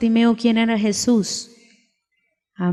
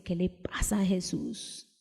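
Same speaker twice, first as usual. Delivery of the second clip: hushed, puzzled whisper; congregation's doubting whisper about Jesus; whispered aside; 5 semitones lower, 13 dB quieter than usual. Retold or imitated. Imagined puzzled listeners whispering.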